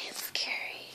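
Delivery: soft scared whisper; hushed aside in the dark woods. Real, not acted. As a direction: breathe it out, spooked. Aside during scary story part.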